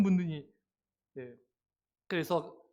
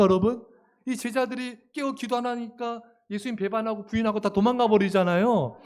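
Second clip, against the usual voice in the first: ordinary talk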